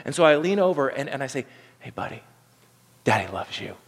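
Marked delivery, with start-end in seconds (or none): 1.8-3.7 a much softer, lower "Daddy loves you"; tender hush. Retold or imitated. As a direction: breathe it gently to a little son. Dad tenderly whispers to son.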